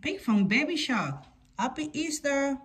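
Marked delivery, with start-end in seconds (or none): none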